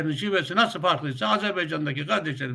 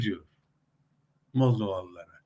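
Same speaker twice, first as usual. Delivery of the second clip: ordinary talk, nothing marked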